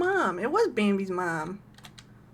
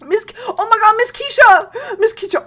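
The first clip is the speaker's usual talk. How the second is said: excited shout; 10 semitones higher, 9 dB louder than usual. Real, not acted. Excited greeting in game.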